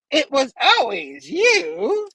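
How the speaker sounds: high, very drawn-out villain voice; hammed-up high goblin voice; theatrical villain reveal. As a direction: stretch each word with menace. Villain's climactic line, acted.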